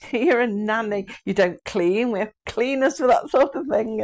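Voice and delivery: lightly hammed-up snobby nanny; scoffing at the narrator for cleaning, in a mock posh voice; snooty posh nanny. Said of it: "this nanny looked down her nose at me".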